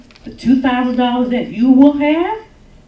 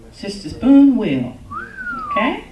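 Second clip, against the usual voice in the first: usual speaking voice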